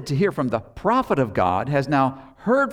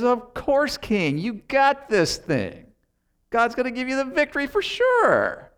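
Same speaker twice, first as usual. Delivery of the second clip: over-the-top sarcasm; 11 semitones higher than usual. Retold or imitated. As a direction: ham up the fake agreement with the king. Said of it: "his sarcasm was really over the top".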